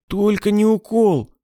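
put-on high whining little boy; whiny child. A narrator's reading. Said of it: "протянул мальчик".

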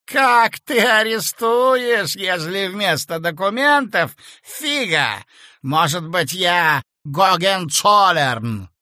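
loud, high, drawn-out challenge; defiant outburst. Acted. Clerk challenging his arrest over missing documents, defiant outburst. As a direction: throw it out loudly, stretching the words.

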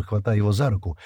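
usual speaking voice

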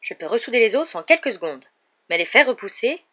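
loud, rising exasperation; scold, climbing to an incredulous question; indignant. A narrator's reading. Furious matron keeps scolding.

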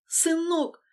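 high-pitched cry to her son; mother's exclamation; exclaiming. A narrator's reading. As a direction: call out to him, overcome. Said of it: "воскликнула она".

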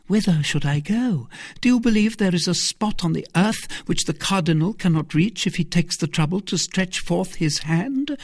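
a hint of a lady playing the helpless victim; Milady. A narrator's reading. Milady pleads her helplessness.

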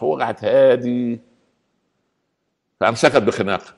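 ordinary talk

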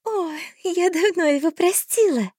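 high, giggly fairy voice; pixie. A narrator's reading. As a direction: say it with a little giggle. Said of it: "захихикала фея".

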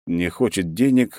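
usual speaking voice

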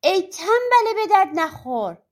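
witch's cross, high-pitched holler; angry yell. A narrator's reading. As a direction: yell crossly at a lazy good-for-nothing. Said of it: "لیودایتکا فریاد کشید".

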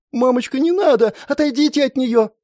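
put-on very high, frightened child's cry; little boy. A narrator's reading. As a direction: plead for mum as a small boy. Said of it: "подал голос ребёнок". (испуганный детский крик)

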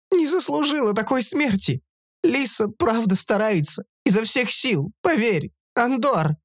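high-pitched, mildly sobbing plea; tearful pleading. A narrator's reading. Lawyer defending Лиса to his friend, tearful. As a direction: plead as if close to sobs. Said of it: "слова вновь захлебнулись в глухих рыданиях".

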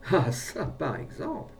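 surprise turning to delight; delighted amazement. A narrator's reading. Brave man repeats himself on hearing the offer, delighted. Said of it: "au ravissement, répéta".

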